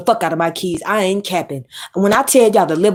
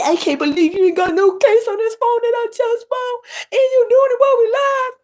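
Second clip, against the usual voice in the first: high, whiny mocking voice way up, imitating a crybaby; mock crying man. Mocking the crying man in a high whiny voice. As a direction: mimic him whining about the phone case.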